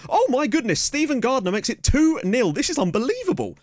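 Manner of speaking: high-pitched, thrilled commentary; excited goal call. Real, not acted. Gamer calling his team's second goal, excited. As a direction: ride high on astonished delight.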